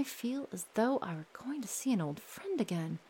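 breathy whispered line. Acted. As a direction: drop to a whisper mid-sentence. Father's line suddenly whispered.

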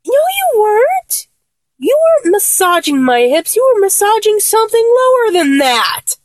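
high, incredulous objection; indignant contradiction. Acted. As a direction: call out the lame excuse. Contradicts friend's excuse about massage.

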